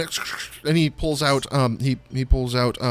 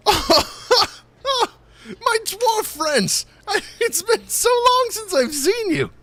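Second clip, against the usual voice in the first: high excited halfling voice; Stefan's voice. Acted. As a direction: greet old friends in a high squeal. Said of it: "he says".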